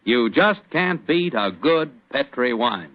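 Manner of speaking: ordinary delivery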